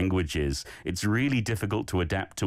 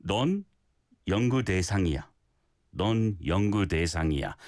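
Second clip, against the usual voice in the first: Korean phrase run smoothly together; flowing together; 4 semitones lower, much faster than usual. Shown as a demonstration. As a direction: link the words into one fluid phrase. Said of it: "flow it together a little more".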